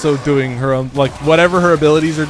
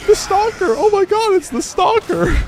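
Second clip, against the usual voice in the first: giddy shriek at a surprise visitor; squealing excitement. Real, not acted. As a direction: shriek with disbelieving joy. Streamer sees Stalker in chat.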